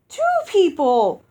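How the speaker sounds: loud, high, joyful giant hostess greeting; booming giantess. Acted. Giantess Uoxi welcoming her guests, loud and joyful. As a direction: boom it out like a delighted giantess.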